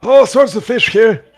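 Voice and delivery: put-on high, loud, folksy old-timer voice; squeaky cheerful old boggart greeting travellers. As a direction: play the friendly old fisherman by the fire. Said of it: "he goes".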